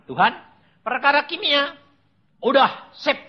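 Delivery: loud, high, self-assured; cocky assurance. Retold or imitated. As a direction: brush God off confidently. Memerankan sesumbar kepada Tuhan. (percaya diri, sesumbar dengan suara lantang)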